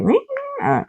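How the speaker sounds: high, soft imitated meow; squeaky mock meow, quiet; cat meow. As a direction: mimic a cat's cry. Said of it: "he was like".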